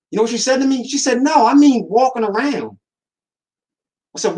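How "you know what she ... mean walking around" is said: excited retelling. Retold or imitated. Building to nurse's answer.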